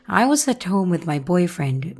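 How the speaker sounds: usual speaking voice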